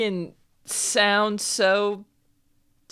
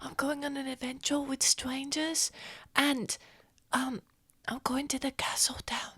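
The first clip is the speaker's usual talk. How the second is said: hushed, higher character voice; secretive young adventurer; 6 semitones higher, 8 dB quieter than usual. Acted. Adventurer confiding in a child about going away, hushed and higher. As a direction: confide softly, partly whispering.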